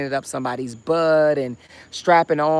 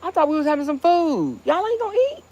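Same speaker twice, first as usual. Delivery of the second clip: calling out; 12 semitones higher, faster than usual. Real, not acted. Calling out to family.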